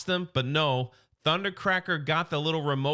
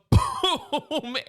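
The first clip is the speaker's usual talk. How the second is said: a quick burst of laughter, pitched up; amused laugh; 8 semitones higher, 4 dB louder than usual. Real, not acted. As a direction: laugh out loud at the explosion. Laughing at the kaboom.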